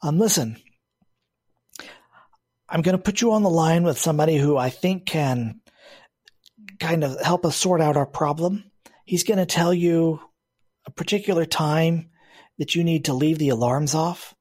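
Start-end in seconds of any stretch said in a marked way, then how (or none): none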